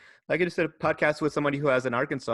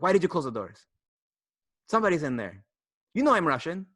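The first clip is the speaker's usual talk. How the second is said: a hint of a Russian accent, imitating his landlady; lightly voiced Russian accent, accusing; mild Russian accent; 4 semitones higher, 4 dB louder than usual. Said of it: "you're being the worst Russian".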